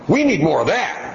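high, bright woman's voice, pleased; approving lady. Retold or imitated. Dad quoting the lady behind him, high approving voice. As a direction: play the approving stranger.

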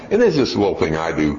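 ordinary talk

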